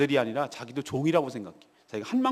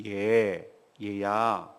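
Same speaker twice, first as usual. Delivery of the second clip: tender father; 9 semitones lower, much slower than usual. Acted. Preacher voicing the father gently calling his angry son. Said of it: "이 말에서 느낄 수 있죠? ... 자상하고".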